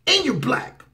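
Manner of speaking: high, hammed-up rant. Commentator mocks what the president never said, high hammed-up rant. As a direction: sneer it as the imagined bully. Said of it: "He didn't say".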